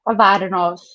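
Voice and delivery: Cavarnos said with the stress on the first syllable; Cavarnos, first syllable stressed, open "var"; native pronunciation. Shown as a demonstration. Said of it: "pronounce your last name for me".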